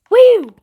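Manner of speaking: loud high whoop; loud excited cowboy whoop. Pretend. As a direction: whoop like a rodeo rider. Cowboy whoop in Wild West game.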